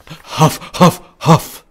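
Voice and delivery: short, forceful puffs; acted panting. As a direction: puff as if winded from a chase. Out of breath in the chase.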